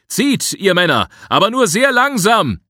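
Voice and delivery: high, full-voiced shouted command; calling out. A narrator's reading. Man on the raft to helpers on the shore, calling out. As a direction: call loudly across the water. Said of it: "rief ich zum Ufer".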